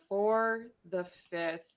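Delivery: slow, drawn-out reading aloud. Acted. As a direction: read it out word by word, slowly. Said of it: "just gonna slowly read that little inscription".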